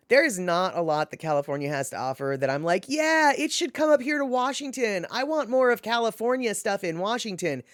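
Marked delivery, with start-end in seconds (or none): 2.9-7.6 higher-pitched, put-on eagerness; mock enthusiasm. Streamer imagining wanting California imports, mock enthusiastic. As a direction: play an eager fan, tongue in cheek.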